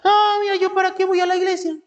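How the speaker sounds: high, rushed mock yelling; gritoneando. Complainer refusing to go to church, high mock yelling. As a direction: holler a fast, high-pitched complaint. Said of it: "todos gritoneando".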